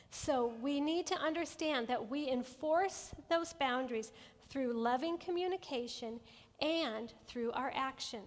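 ordinary delivery

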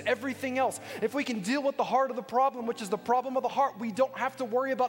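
high-pitched, insistent sermon climax; forceful preaching. Real, not acted. Preacher telling believers the Spirit lives within, insistent preaching. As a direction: drive each point home to the congregation.

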